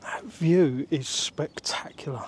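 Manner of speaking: slow breathy whisper of awe. Real, not acted. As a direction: breathe it out in wonder.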